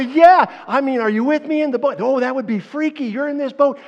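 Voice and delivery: loud and high, all worked up; excited exclamation. Retold or imitated. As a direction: retell it with wide-eyed excitement. Excited retold exchange.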